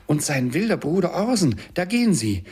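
high, loud and thrilled; excited cry. A narrator's reading. Scrooge watches storybook brothers pass, crying out excitedly. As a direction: exclaim as if pointing them out. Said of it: "rief Scrooge".

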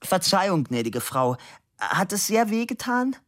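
high-pitched, smiling apology; calling out. A narrator's reading. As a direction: call out a showy apology. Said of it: "Er lächelte ihr zu und rief".

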